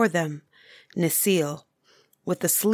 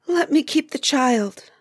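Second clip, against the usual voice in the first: trembling plea; 7 semitones higher, 3 dB louder than usual. A narrator's reading. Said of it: "beginning to tremble".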